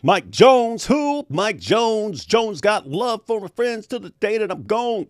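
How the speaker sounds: high, loud rap-hook callout; rapper hook impression. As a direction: shout it like the song's hype man. Quoting the [name] song hook.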